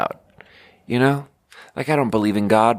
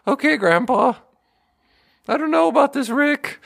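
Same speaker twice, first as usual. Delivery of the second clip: put-on much higher nervous teen whine; anxious Morty backing off from Grandpa; Morty impression. Morty nervously answers his grandpa Rick, much higher whine. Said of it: "impersonation of Morty".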